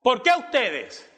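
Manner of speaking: high, drawn-out retold reproach; reproachful demand. Missionary confronts the neighbours for not helping, reproachful. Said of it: "Y él le reclamaba a los vecinos".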